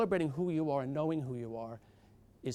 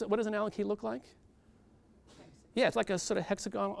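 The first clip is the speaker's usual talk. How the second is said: ordinary talk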